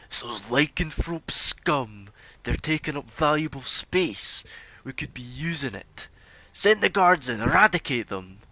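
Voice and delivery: a bigot in the mob whipping up the crowd; angry heckler. Acted. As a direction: demand the guards go in. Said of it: "you hear a guy speak up".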